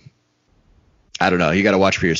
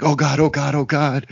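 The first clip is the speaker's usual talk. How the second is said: mock panic; 5 semitones higher than usual. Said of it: "pure panic mode".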